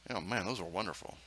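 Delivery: ordinary talk, nothing marked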